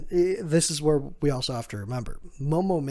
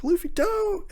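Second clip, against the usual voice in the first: panicked plea. Retold or imitated. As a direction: yelp it at your captain in alarm. Crew panics as he steers off.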